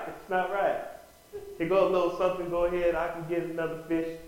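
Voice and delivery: ordinary talk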